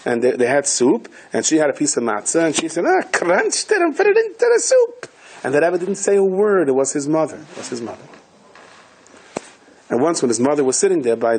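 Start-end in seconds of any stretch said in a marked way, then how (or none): 2.9-5.1 high voiced old mother, much higher. Old mother at the soup telling her son, lightly voiced. 7.4-8.0 much softer, almost under breath; soft echo. Shown as a demonstration. Repeating to listeners, his mother, hushed. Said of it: "repeated softly".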